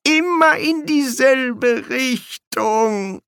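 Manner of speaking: high, slow, drawn-out whine; dazed murmuring. A narrator's reading. Bursar hears the distant noise, murmuring in dread. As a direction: babble it in a high, unhinged voice. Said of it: "murmelte der Quästor".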